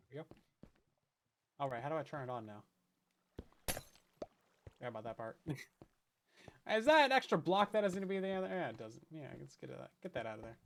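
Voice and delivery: ordinary talk, nothing marked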